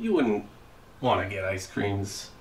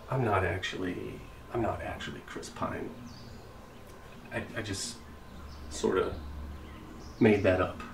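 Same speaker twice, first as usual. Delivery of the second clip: much softer, near whispered admission; sheepish confession; 2 semitones lower, 8 dB quieter than usual. Real, not acted. Dad admitting to a stranger he faked being Chris Pine, almost whispering. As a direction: own up quietly and embarrassed.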